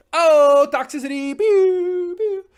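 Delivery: shrieks toxicity high and loud, then blaster noises; mock alarm and pew pew. Streamer shrieking at a toxic chat message, high mock alarm with pew pew blasts. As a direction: squeal it like a cartoon alarm, then zap it away.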